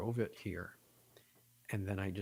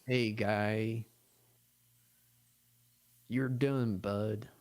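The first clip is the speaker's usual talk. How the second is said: louder mock Canadian accent; hammed-up Canadian accent, louder; 6 dB louder, much slower than usual. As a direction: lay on a thick Canadian twang, loud. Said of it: "the really thick Canadian accent".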